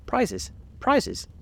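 high, eager crowd cries; confused calling out. A narrator's reading. Whole party crowding round Alice, calling out confusedly. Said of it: "calling out in a confused way".

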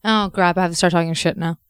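ordinary talk

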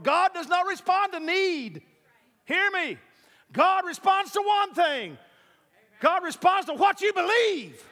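high, impassioned preaching. Real, not acted. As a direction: build to a fervent peak. Preaching at full force.

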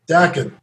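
"Daken" with short A, as in "back"; Daken as DACK-en. Shown as a demonstration. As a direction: say "Daken" to rhyme with "blacken". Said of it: "Oh, here it is".

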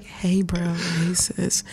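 hushed, exaggeratedly smooth greeting; mock sexy voice. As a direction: imitate being accused of a bedroom voice. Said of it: "he feel like I be like".

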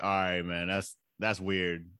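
low, slow, lightly acted street guy; lightly voiced thug. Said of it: "even thugs are like".

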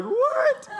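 very high, incredulous yelp; comic high-pitched outburst; mock shock. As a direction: squeak it out in exaggerated disbelief. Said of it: "I was like".

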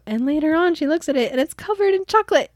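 ordinary talk

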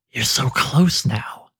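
slow, breathy unseen whisper from the dark; eerie whisper. Acted. The Hooded One taunts wounded Anduin in the cavern, slow eerie whisper. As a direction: breathe it like a lurking presence.

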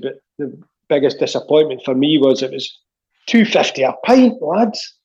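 put-on doing the manager's Fife accent. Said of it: "Leish's old imitable style, his Fife accent".

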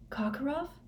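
high, soft startled gasp of a name; wild question. A narrator's reading. Harry reacts to Moody's hint, wildly startled. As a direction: Karkaroff pitched high in wild disbelief. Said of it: "said Harry wildly".

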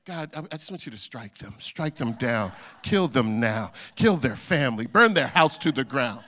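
mock prayer for vengeance, hammering each demand; vengeful prayer. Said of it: "Don't let your mouth be moving".

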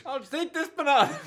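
high-pitched put-on old man. Aged Narantia repeats his banana plan, old man voice. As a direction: pipe up like a dotty old man. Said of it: "like an old man".

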